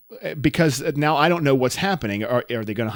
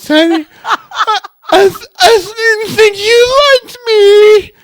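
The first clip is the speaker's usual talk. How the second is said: hammed-up bawling, very high and loud; Katie's pathetic crying; 11 dB louder, slower than usual. Co-host mocks friend crying at best man request, exaggerated sobbing wail. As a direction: imitate a horrendous crier, wailing slowly at full volume. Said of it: "as she comes out of this crying".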